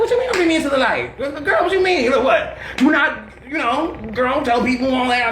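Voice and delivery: high-pitched, incredulous retold protest; imitating a worked-up, amused objection; mock disbelief. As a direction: squeal it in acted-out disbelief. Retold indignant reply to friend.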